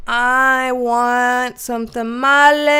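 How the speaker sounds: very slow, stretched-out whine of longing; drawn-out craving. Pretend. Host hearing of a viewer's tamales, comically longing. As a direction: drag out every word, swelling at the end.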